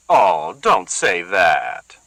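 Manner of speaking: slow, sour, dripping sarcasm; soft sarcastic sneer. Acted. Bertha mocks Celia in a soft, slow, sour sneer. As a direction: sneer gently and slowly. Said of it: "gentle but sour, soft yet sneering".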